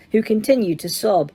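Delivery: usual speaking voice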